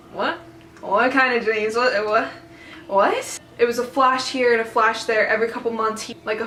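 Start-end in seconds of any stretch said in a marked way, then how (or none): 0.1-3.4 bewildered questions, the last what shooting up in pitch; baffled reaction. Retold or imitated. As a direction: act out total confusion, stumbling over what. Her baffled reaction to his dreams.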